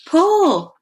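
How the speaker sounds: high loud shout; frantic shout. Acted. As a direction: yell at them to pull. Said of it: "Sid shouted".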